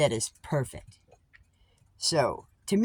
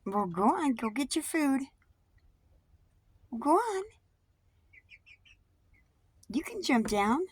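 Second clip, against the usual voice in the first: pet talk; 3 dB quieter than usual. Real, not acted. To her birds, coaxing them down to eat, in a high pet voice. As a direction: sweet-talk the birds down to their food.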